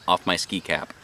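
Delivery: ordinary delivery, nothing marked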